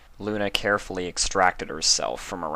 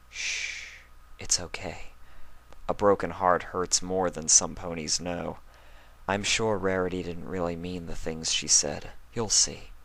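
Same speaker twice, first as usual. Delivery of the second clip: softer, hushed and soothing; consoling; 3 dB quieter than usual. Acted. Luna consoling sobbing Spike, soft and soothing. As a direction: hush him like a crying child. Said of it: "trying to console him".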